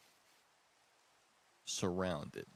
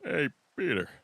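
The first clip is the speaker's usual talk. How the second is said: a hint of a louder, higher cartoon neighbor; imitating Joe; 5 semitones higher, 8 dB louder than usual. Showing friends how Joe greets Peter, a hint of Joe. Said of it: "Joe's more like this".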